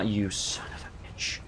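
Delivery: under-the-breath whispered curse; muttered curse. Real, not acted. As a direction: hiss it under your breath. Loot stolen by a bear.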